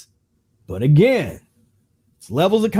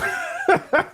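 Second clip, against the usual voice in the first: high laugh; 5 dB quieter than usual. Real, not acted. Laughs at own rhetorical question.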